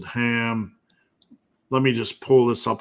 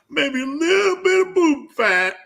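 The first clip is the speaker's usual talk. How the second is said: very high, squeaky voice; silly high-pitched squeal; playful squeal; 4 dB louder than usual. Pretend. Comically squeezing out a small amount.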